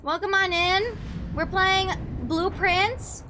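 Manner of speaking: high bright welcome, drawn out; streamer greeting. Acted. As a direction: greet the chat like a host.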